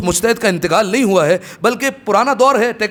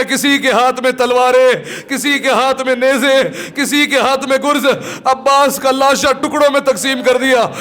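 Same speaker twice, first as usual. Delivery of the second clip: grieving majlis recitation; mournful elegy recitation; 4 semitones higher than usual. Acted. Preacher recounts Abbas's body being cut down, mournful recitation. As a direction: lament the fallen hero, phrase by phrase.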